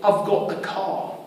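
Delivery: soft, rough attempt at a British accent. Shown as a demonstration. Teacher admits he can't do it well, attempting British accent. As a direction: try a British accent, quietly and a bit unsurely. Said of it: "British accent ... sounds a little bit like".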